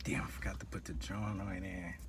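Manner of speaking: sneaky whisper. Retold or imitated. Dealer whispering a guilty excuse.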